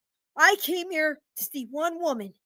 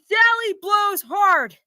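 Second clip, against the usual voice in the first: very slow, louder and higher; shouted slogan; 5 semitones higher, 4 dB louder, much slower than usual. Acted. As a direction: bellow the ad word by word. Customer repeats shouting.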